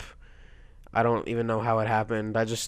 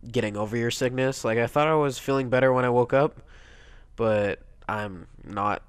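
usual speaking voice

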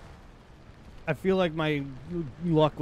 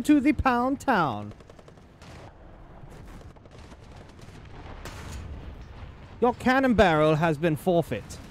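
hammy villain commander; 11 semitones higher than usual. Acted. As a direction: taunt the enemy like a cartoon villain. Taunting enemy tanks in character.

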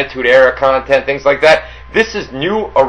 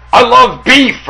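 mock heckler shout, pitched up; raised, higher retold yell; imitated yell; 8 semitones higher, 4 dB louder, slower than usual. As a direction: call it out like a golf-course heckler. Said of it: "he yelled something like".

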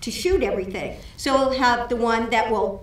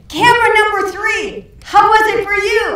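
much higher, much louder, fast hollered question; shouting up to the catwalk; 11 semitones higher, 9 dB louder than usual. Retold or imitated. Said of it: "So he says".

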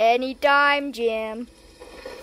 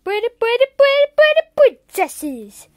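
pretty, pretty, higher and louder; excited cooing; 7 semitones higher, 8 dB louder than usual. Acted. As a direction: gush over the princesses. Cooing over toy princesses.

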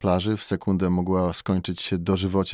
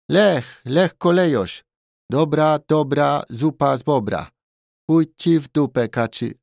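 high, loud show-off with foreign phrases; merry Thai barman; 9 semitones higher, 5 dB louder than usual. A narrator's reading. Thai barman showing off his Polish to a tourist, merry and loud. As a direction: rattle off learned Polish proudly, high and loud.

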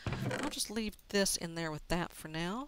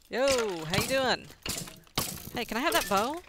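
louder cheeky call across the field; friendly call; 4 semitones higher, 6 dB louder than usual. Real, not acted. Player cheekily asking a skeleton for its bow, loud call.

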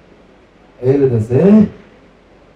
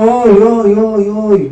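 mock wailing; 7 semitones higher, 3 dB louder, slower than usual. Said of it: "בבכי גדול".

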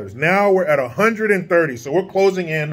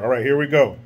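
normal talking voice

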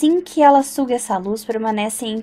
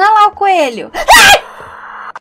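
startled scream; 9 semitones higher, 9 dB louder, much slower than usual. Real, not acted. Rabbit monster appears.

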